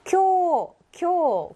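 teacher's model 쿄 with drawn-out o; long o. Shown as a demonstration. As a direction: stretch 쿄 without any 우. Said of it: "오 자음이 된다고".

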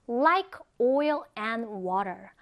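slow, drawn-out "Like oil and water"; slow model reading. Shown as a demonstration. Teacher models an English idiom for learners, slow and deliberate. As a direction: stretch out each word of "Like oil and water" for learners. Models phrase slowly, then quicker.

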